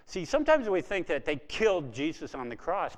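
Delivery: ordinary delivery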